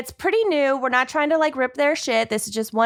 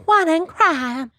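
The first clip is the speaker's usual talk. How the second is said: [name] impression; 5 semitones higher than usual. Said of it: "You're both really good at it".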